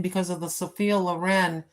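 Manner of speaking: usual speaking voice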